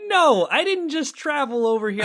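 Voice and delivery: high-pitched incredulous refusal; retold indignant protest. Hungry customer refusing to accept the gas station, high indignant protest.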